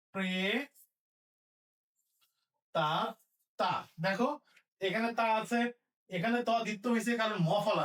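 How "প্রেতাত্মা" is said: very slow drawn-out word; drawn-out dictation. Shown as a demonstration. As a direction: stretch it for writing. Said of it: "dictating word".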